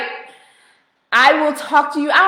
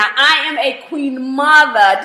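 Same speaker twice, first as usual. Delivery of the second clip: ordinary talk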